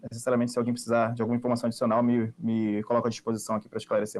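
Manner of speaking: fast, hurried words; rushing before cutoff. Real, not acted. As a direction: race through it before time runs out. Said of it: "peço desculpa pela pressa".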